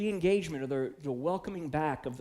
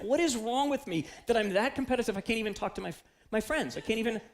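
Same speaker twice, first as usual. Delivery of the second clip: agitated retold self-talk; 7 semitones higher than usual. Acting out his bench sulk.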